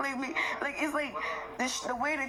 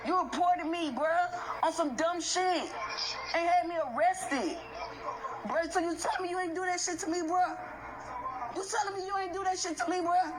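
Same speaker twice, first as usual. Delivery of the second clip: higher, rising anger, pressing him hard; heated confrontation; 6 semitones higher than usual. Real, not acted. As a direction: keep hammering him, demanding he own up. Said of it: "Let me calm down".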